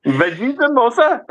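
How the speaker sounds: high, put-on Indian accent; mock Indian accent. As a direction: chime in with a cartoon Indian accent.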